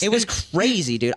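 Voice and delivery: ordinary talk, nothing marked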